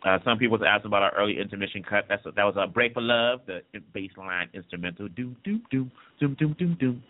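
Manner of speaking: normal talking voice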